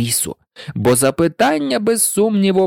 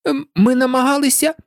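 meek, high-pitched excuse; hesitant humble servant; very submissive; 11 semitones higher than usual. A narrator's reading. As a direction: explain timidly to a monarch. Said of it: "дуже покірним голосом".

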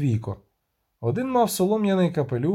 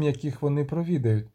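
usual speaking voice

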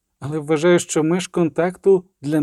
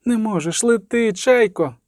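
put-on high, otherworldly girl's command; voice through girl; 7 semitones higher than usual. A narrator's reading. Voice through the girl's lips sending Triss away, high command. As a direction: pitch it up as a voice speaking through a child. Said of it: "сказав голос устами дівчинки".

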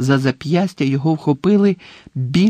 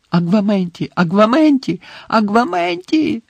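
escalating desperation; 7 semitones higher than usual. A narrator's reading. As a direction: spell climbing higher with each repeat. Harry repeats the failing spell in desperation.

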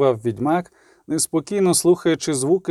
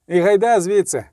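higher, lifted call to get going; urgent shout; 5 semitones higher, 4 dB louder than usual. A narrator's reading. Kahir urging the witcher to flee, urgent raised call. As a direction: call out a hurried order. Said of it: "крикнув Кагір".

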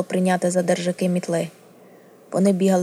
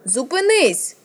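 higher-pitched narrated cry; desperate yell; 6 semitones higher, 3 dB louder, slower than usual. A narrator's reading. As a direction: cry out to halt an attack. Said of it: "заволала Арія".

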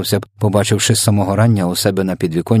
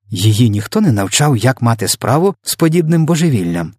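ordinary delivery

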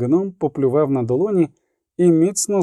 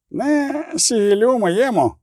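dwarf growling; 9 semitones higher, slower than usual. A narrator's reading. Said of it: "прогарчав Золтан".

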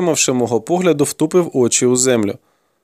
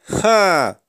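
short, higher-pitched "Ha!"; exclaiming; 6 semitones higher than usual. A narrator's reading. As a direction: let out a brief exclamation. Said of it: "скрикнув Ганелон".

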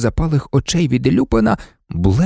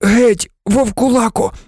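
pained, high-voiced outburst; groaned; 10 semitones higher than usual. A narrator's reading. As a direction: groan it out, driving him away. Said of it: "Рон простогнав".

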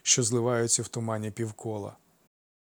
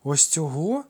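higher, rising question of disbelief; incredulous gasp; 5 semitones higher, 4 dB louder, slower than usual. A narrator's reading. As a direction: ask it as if you can't believe it. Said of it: "недовірливо ахнув Ефікл". (недовірливе здивування)

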